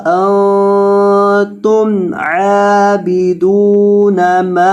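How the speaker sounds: slow, melodic Quranic chant; Quran recitation. Acted. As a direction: recite it measured and drawn out. (धीमी सुरीली तिलावत)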